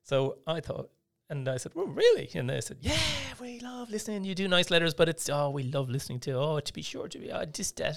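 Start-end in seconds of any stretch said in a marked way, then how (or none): 1.8-2.3 high, louder incredulous question; surprised. Retold or imitated. Trainee answering the secretaries' praise, surprised. 2.8-8.0 high-pitched gushing, teasing imitation; teasing secretaries. Secretaries having a go at the Irish trainee, teasing. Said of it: "they all having a go at me".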